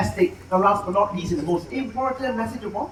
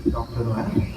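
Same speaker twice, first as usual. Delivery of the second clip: documentary show narration; 3 dB quieter, slower than usual. A narrator's reading. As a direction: draw the audience into the night, slowly. Show narration over music.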